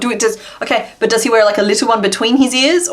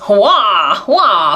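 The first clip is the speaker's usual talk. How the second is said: a light, slightly louder wah wah cartoon whine; Waluigi; 3 dB louder than usual. Crafter voicing the crochet villain like Waluigi, light mock wah. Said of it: "Like a real Waluigi type of thing".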